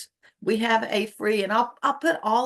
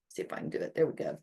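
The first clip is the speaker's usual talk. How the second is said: whispered aside; 6 semitones lower, 9 dB quieter, much faster than usual. Real, not acted. Muttering to herself while fiddling.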